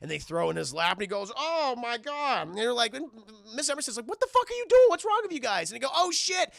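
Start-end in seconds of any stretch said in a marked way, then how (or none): 1.4-2.5 high, drawn-out dismay; acted yelp of shock, stretched out; shocked exclamation. Man reacts to water thrown in his lap, shocked. 4.1-5.7 high, loud screechy scolding; outraged mother. Retold or imitated. Mother yells at her boys at dinner, outraged. 5.9-6.5 high, loud mock panic; panicked exclamation. Boys react when the plan goes wrong, panicked.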